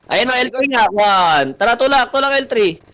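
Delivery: high, urgent shouting to push forward; rally the team to charge now; urgent high-pitched callout; excited push call. Real, not acted.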